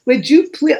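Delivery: ordinary delivery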